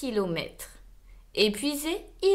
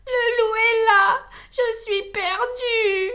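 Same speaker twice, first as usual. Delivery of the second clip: high, loud sobbing rabbit voice, slowing at the end; crying little rabbit; 10 semitones higher, 5 dB louder, slower than usual. Acted. Trapped rabbit cries out at the wolf, high sobbing voice. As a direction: wail it like a terrified little bunny. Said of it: "pleura une autre voix".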